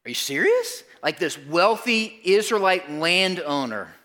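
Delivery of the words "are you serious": higher, incredulous "Are you serious?"; shocked disbelief. Retold or imitated. Listener hearing shocking news, incredulous and higher. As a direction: act out the congregation's double-take. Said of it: "that moment where it's like".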